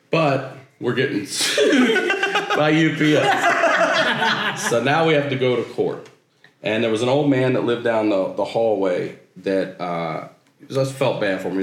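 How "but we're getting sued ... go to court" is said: laughing. Real, not acted. Absurd outcome, laughing.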